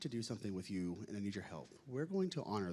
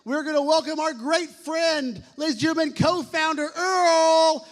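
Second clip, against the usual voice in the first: booming high arena announcer; announcer call; 21 dB louder, slower than usual. Acted. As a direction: boom the name out like a ring announcer. Emcee introduces guest to crowd.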